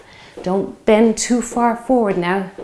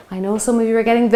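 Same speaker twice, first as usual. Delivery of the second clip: usual speaking voice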